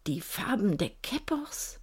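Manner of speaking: hushed, devout whisper; he murmurs the clan colours reverently. Acted. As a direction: whisper it in awe. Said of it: "murmelte er andächtig".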